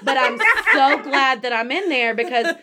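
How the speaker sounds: loud laughing while talking. Real, not acted. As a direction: laugh through the line. Laughs at own joke.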